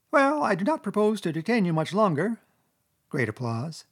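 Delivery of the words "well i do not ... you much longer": high-pitched speechifying Chief Weasel; high squeaky voice. A narrator's reading. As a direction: address the banquet in a high squeak. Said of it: "began in a high, squeaky voice".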